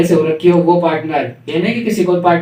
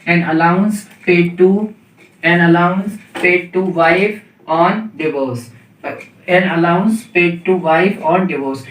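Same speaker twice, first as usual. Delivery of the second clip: slow dictation; much slower than usual. Shown as a demonstration. Teacher dictating a definition.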